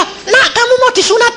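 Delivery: high, laughing mock mother; a mum coaxing her son; mother asking sweetly. Mother voiced in story. (ibu membujuk anak dengan suara tinggi sambil tertawa)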